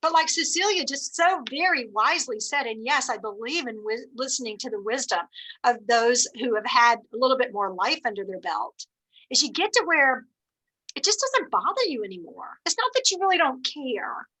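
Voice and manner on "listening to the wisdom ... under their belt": normal talking voice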